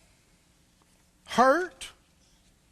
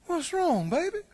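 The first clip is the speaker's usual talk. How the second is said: higher, softer cooing wife; mild coaxing wife; 8 semitones higher, 4 dB quieter than usual. Retold or imitated. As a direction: lightly coo like the wife. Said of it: "she said to him".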